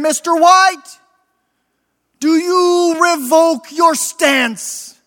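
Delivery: slow, high pleading bishop; a bishop urging him to recant, drawn out. Acted. Bishop to prisoner in his cell, slow high plea. Bishop questions condemned man.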